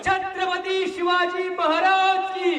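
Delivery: high, drawn-out ceremonial herald's shout; Shivgarjana war cry. Real, not acted. Orator rousing sleeping mavlas with Shivgarjana, slow roaring proclamation. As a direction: proclaim each title at full voice, stretching it out. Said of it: "एक शिवगर्जना करतो".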